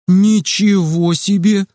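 slow, drawn-out amazement; amazed whistle. A narrator's reading. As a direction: stretch ничего in wonder. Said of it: "присвистнул он". (протяжно и изумлённо, «ничего» растянуто)

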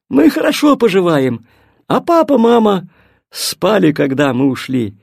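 usual speaking voice

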